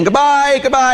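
high singsong goodbye; acted hurried farewell, drawn high; calling out farewells. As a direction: call it back over your shoulder. Calling farewells while running out.

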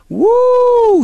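high loud whoop of release; shaking off the accuser with a whoop. Real, not acted. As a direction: let out a sharp high woo. After voicing a hostile critic.

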